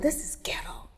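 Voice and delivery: whispered aside to a friend; hushed complaint. Retold or imitated. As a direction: whisper it in disbelief.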